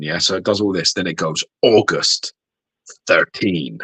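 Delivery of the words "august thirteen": advert voice saying thirteen. Retold or imitated. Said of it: "So it'll be like this".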